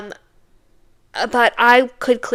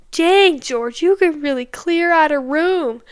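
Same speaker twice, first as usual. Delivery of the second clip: teasing the dog; 6 semitones higher, 4 dB louder than usual. Retold or imitated. Date teases the dog.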